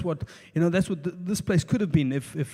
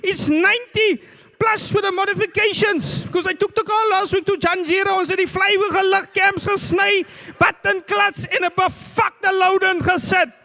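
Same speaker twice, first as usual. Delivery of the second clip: high-pitched full-volume driver's rant; shouting angrily; 7 dB louder than usual. Retold or imitated. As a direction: yell it furiously at another driver, voice way up high. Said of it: "he started shouting at me".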